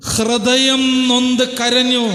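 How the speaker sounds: high, slow, loud wail of heartbreak; ഉച്ചത്തിൽ പതുക്കെ വിലാപം; anguished cry. Acted. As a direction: stretch each word out in a loud, high lament. Preacher climaxes on Hannah's weeping.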